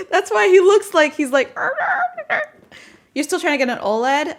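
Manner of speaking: laughing while talking. Real, not acted. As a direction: talk through the giggles. Laughing at partner.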